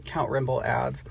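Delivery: normal talking voice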